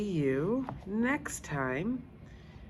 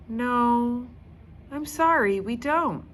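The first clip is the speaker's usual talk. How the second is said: high, louder, sorry-sounding guide; apologetic guide; 7 semitones higher, 3 dB louder than usual. Acted. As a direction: gently let the girl down. Guide answers Judy.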